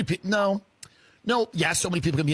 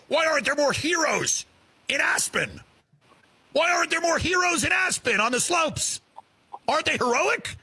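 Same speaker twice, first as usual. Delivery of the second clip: sarcastic shout; 7 semitones higher than usual. Acted. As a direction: sneer it up the slope. Mocking rhetorical shouting.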